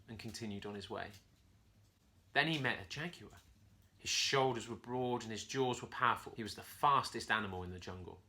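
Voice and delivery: ordinary delivery, nothing marked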